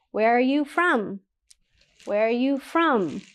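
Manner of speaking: "Where are you from?" dipping slightly on "from"; teacher modeling a gentle drop in "from"; falls a little at the end. Shown as a demonstration. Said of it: "It goes down a little bit".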